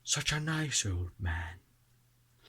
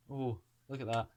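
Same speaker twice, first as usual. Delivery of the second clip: ordinary delivery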